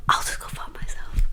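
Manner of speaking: soft bitter repeat, almost voiceless; whispered sarcastic echo; whispered aside. Retold or imitated. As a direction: mutter it under the breath.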